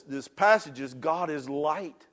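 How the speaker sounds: preacher swelling toward salvation; rising preaching. Acted. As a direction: build to the altar call. Building preaching climax.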